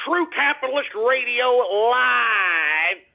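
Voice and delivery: slow, drawn-out, shouted station call; announcer slogan. Acted. Host proclaiming his show name to listeners, announcer boom. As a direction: boom it out like a radio ident.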